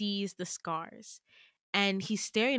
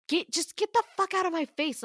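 high-pitched angry outburst; furious dismissal; 10 semitones higher, 4 dB louder than usual. Acted. Girl telling Damien to back off, furious dismissal. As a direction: tell them off at raised pitch.

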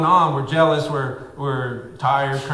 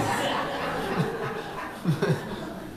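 laugh; 8 dB quieter than usual. Real, not acted. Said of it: "I'm just so excited".

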